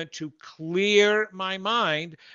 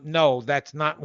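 normal talking voice

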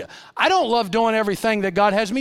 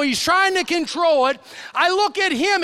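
ordinary talk

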